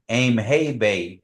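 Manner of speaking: Aim hey bay said slowly, each word apart; slow model phrase. Shown as a demonstration. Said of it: "Everybody say that".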